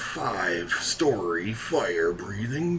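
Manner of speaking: high, hyped-up mock event promo; hammed-up arena announcer voice; dungeon boss announcer. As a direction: announce it big, drawing out each name. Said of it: "doing the dungeon boss announcer voice".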